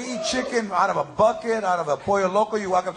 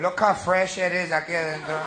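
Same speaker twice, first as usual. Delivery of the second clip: bragging uncle; 6 semitones lower, 4 dB quieter than usual. Retold or imitated. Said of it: "he's always bragging".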